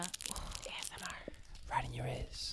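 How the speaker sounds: hushed, voiceless whisper; ASMR whisper. Pretend. Podcaster teasing listeners with candy noises, ASMR whisper. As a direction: whisper it softly right into the mic.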